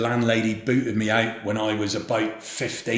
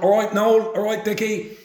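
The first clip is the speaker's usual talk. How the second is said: high-pitched roadside hail; called greeting; 9 semitones higher than usual. Retold or imitated. Villagers hailing each other as they pass, high called greeting.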